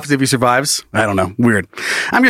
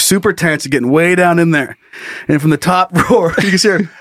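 ordinary talk

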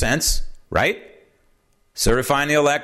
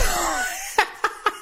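airy laugh; 5 dB quieter than usual. Real, not acted. Laughing at the story.

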